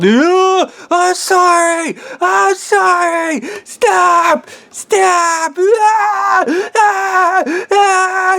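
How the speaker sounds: very high, loud drawn-out wails; hammed-up screams of pain and pleading; acted agonized screaming. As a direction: shriek the apology like you're being tortured. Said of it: "that guy is screaming".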